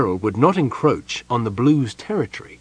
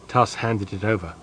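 usual speaking voice

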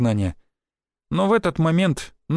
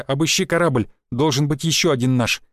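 plain reading voice

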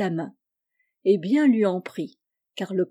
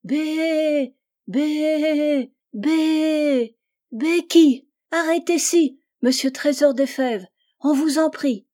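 high bleating nanny goat calling out; 7 semitones higher, 5 dB louder, slower than usual. Acted. She-goat calls to the passing boy, high and bleating.

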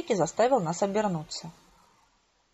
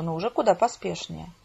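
ordinary delivery, nothing marked

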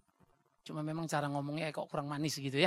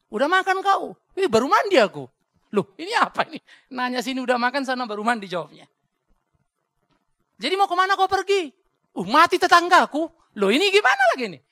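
high, loud shouted back-and-forth; acted dialogue; 9 semitones higher, 13 dB louder than usual. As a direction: yell both sides of a confused chat. Mock mismatched conversation. (percakapan pura-pura yang keras dan tinggi)

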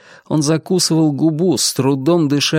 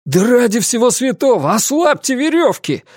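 exclaiming; 10 semitones higher than usual. Acted. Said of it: "воскликнул он".

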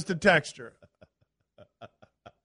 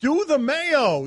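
mock shriek; 8 semitones higher, much slower than usual. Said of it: "says a shrieking".

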